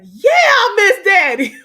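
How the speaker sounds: loud, high, drawn-out gushing answer; eager reply. Retold or imitated. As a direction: act out swooning eagerness, slowly and loudly. Acts her eager answer to him.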